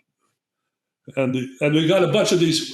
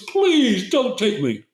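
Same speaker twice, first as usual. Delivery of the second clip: begging; 7 semitones higher, slower than usual. Retold or imitated. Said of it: "He begging me, begging me".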